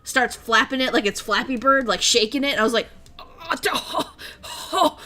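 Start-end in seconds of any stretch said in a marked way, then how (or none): 3.2-5.0 string of aghast little gasps; horrified gasps. Retold or imitated. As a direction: act out speechless horror with gasped ohs. Retold horror as kid shakes statue.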